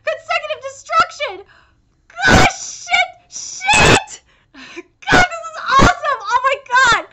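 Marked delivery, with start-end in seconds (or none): 2.1-7.1 excited outburst. Real, not acted. Reader thrilled by a manga chapter, excited outburst. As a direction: blurt it out, amazed.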